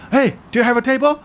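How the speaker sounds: high, fast, loud imitation of a pushy customer; mock barging-in shout; loud interrupting. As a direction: butt in abruptly, calling out. Acts customer butting in at restaurant.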